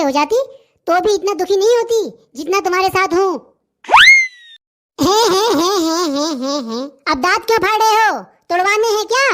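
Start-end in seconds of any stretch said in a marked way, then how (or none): 5.0-6.8 mocking he-he laugh that sinks lower and quieter; teasing snicker trailing off; fake laugh. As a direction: snigger it out, fading down. Mocking laugh, then told off.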